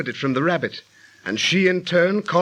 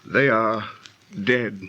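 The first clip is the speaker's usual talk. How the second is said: grieving report; 6 semitones lower, slower than usual. Acted. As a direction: break the news of death mournfully. Survivor reports dead comrades.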